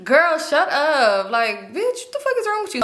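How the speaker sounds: drawn-out "shut up", then high disbelief; irritated. Retold or imitated. Annoyed woman telling off her joking friend, irritated. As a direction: snap at a friend in exasperation. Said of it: "this girl just irritated me".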